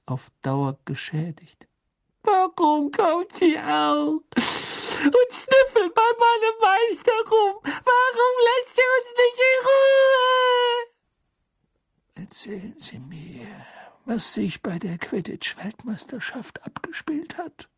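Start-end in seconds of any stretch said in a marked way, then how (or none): 2.2-10.9 shrill squeaky elf, loud and slow; wailing house-elf. Acted. 12.2-17.7 hushed urgent interrogator whisper; whispered questioning. Acted.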